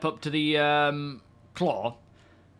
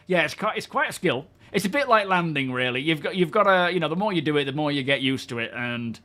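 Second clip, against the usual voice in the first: usual speaking voice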